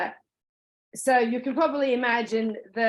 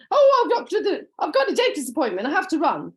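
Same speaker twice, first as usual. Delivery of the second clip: much higher and louder, hammed-up hurry; mock flustered excuse; 6 semitones higher, 5 dB louder than usual. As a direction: play a customer inventing a dentist escape. Customer's fake excuse, laughing.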